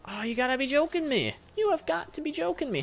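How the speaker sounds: high, strained disbelief; incredulous indignation. Real, not acted. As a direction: protest in a high exasperated voice. Player reacts to vandalism.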